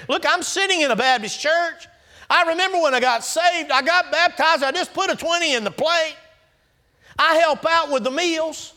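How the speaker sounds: mocking a self-assured churchgoer; smug churchgoer. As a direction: boast like a comfortable pew-sitter. Mimicking complacent churchgoer.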